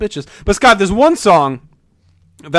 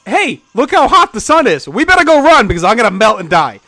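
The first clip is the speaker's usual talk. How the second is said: cheery Frosty voice; 6 semitones higher, 5 dB louder than usual. Retold or imitated. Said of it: "the guy's like".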